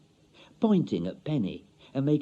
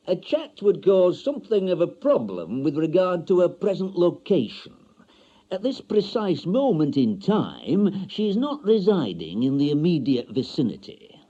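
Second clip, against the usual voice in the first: higher, louder officious salesman voice; pompous bureau man; 7 semitones higher, 5 dB louder than usual. Acted. Marriage bureau man telling Mister Stanley, officious and loud. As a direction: lay on the formal jargon.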